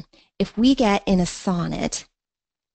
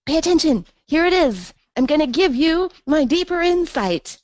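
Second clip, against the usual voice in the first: much higher and louder mock proclamation; voicing the poet; 9 semitones higher, 5 dB louder than usual. Teacher voicing the poet calling readers to attention. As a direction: announce it like a poet showing off his insight. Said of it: "It's like he's saying".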